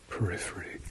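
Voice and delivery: ordinary talk, nothing marked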